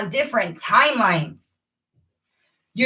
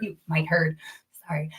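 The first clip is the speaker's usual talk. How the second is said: whispered echo of the line; 7 semitones lower, 9 dB quieter than usual. Real, not acted. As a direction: drop to a soft whisper. Repeated, then whispered.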